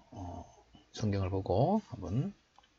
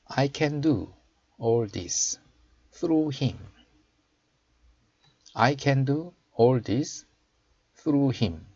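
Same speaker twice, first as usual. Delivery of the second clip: slow, raised teaching voice; slow model reading; 4 semitones higher, 3 dB louder, much slower than usual. Shown as a demonstration. Teacher showing learners the English verse, slow model reading. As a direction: read it out slowly for learners to copy.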